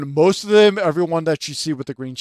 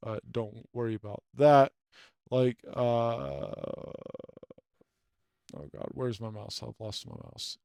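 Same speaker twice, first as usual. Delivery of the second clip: muttering to self; 8 semitones lower, 15 dB quieter than usual. Real, not acted. Muttering to himself while searching.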